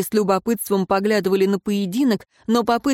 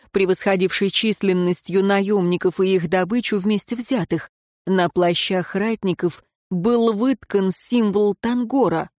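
ordinary delivery

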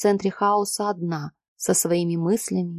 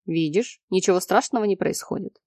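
plain reading voice

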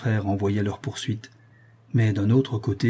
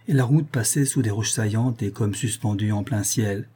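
plain reading voice

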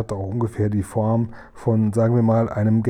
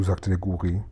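ordinary delivery

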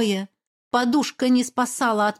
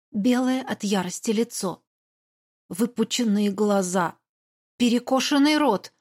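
ordinary delivery, nothing marked

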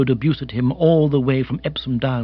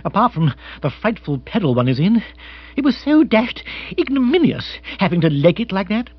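increasingly high, flustered complaint; agitated lord, indignant; 5 semitones higher, 3 dB louder than usual. Acted. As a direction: aristocrat bewailing an indignity.

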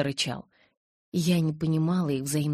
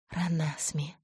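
frightened whisper of a name; 5 dB quieter, slower than usual. Acted. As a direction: barely breathe the name. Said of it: "прошептала я".

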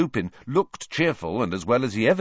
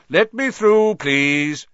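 higher, louder, pompous; self-important; 8 semitones higher, 8 dB louder, slower than usual. A narrator's reading. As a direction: bustle through importantly. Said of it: "came bustling importantly".

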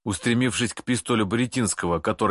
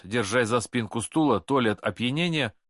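usual speaking voice

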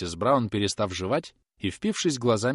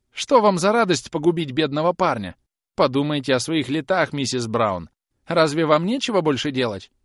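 higher, louder reproach; exasperated pleading; 7 semitones higher, 6 dB louder than usual. Acted. Groom begs old woman to leave a poor lad alone, exasperated. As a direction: plead in a raised, fretful voice.

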